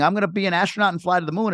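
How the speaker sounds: lightly voiced kid, high and fast, dreaming big; a hint of a bragging child, quick; eight-year-old boast. Host imitating a kid to his guest, lightly voiced boastful child. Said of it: "as an eight-year-old".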